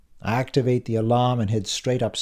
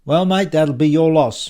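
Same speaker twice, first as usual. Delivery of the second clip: loud, higher, scornful parting shot; raised voice full of disgust; disgusted colleague; 7 semitones higher, 9 dB louder than usual. A narrator's reading. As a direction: dismiss a mate with a curled lip. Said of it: "curling his top lip in disgust".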